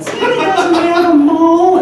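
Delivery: put-on high, loud child's voice; imitated kid. Kid challenging a mall Santa, loud and high. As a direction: call it out as a mouthy little kid. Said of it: "kids would come up".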